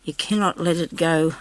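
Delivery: ordinary talk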